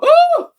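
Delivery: high, loud, drawn-out "ooh"; loud mock "ooh". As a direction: imitate a scandalized onlooker's ooh. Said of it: "you hear the lady go".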